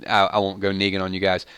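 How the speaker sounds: normal talking voice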